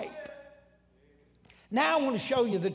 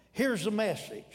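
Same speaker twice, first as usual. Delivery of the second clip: normal talking voice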